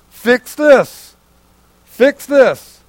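loud, high, urgent charge; firm command. Real, not acted. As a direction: drive it home to the fathers. Preacher commanding fathers.

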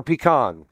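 "pecan" said with a KAHN ending; second pronunciation. Shown as a demonstration. Preacher offering the church the other option, "pecan" as pee-KAHN. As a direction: say "pecan" with a broad ah at the end. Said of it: "is it pecan or pecan".

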